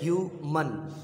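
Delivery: "ह्यूमन" slowly, as ह्यू, मन; "ह्यूमन" with a gap between ह्यू and मन; split into syllables. Shown as a demonstration. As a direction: break "ह्यूमन" into two syllables. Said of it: "अलग अलग ... तोड़ के बता दिए हैं".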